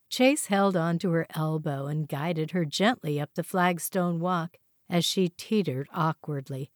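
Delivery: ordinary delivery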